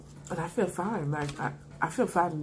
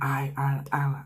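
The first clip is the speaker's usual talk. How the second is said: whisper; 3 dB louder than usual. Retold or imitated. Pacing, panicked, calming herself.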